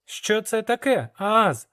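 put-on eager boy asking his mentor; young apprentice. Young hero asks curious question.

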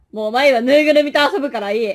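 ordinary talk

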